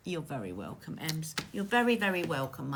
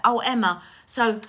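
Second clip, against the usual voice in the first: ordinary talk, nothing marked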